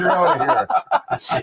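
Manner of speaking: slow, high, breathy umpire call; laughing umpire call. Pretend.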